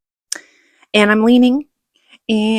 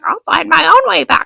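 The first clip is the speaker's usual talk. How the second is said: mock angry comeback, higher and louder; retold angry retort; 8 semitones higher, 4 dB louder than usual. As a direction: snap it back, pissed off. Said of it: "and I'm just like".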